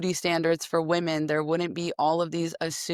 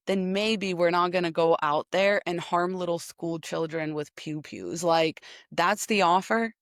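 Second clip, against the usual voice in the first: ordinary talk, nothing marked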